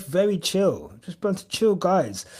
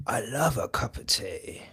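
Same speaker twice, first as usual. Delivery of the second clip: whisper; 6 semitones lower, 7 dB quieter than usual. Real, not acted. Whispering fondly about tea.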